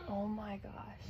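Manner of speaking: awed whisper of oh my gosh. Real, not acted. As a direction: breathe it out in awe. Awed whisper over gift.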